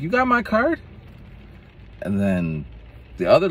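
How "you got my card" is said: high, eager and kind; high caring voice. Retold or imitated. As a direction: offer the card sweetly. Instructor asking.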